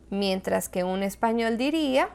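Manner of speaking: ordinary talk, nothing marked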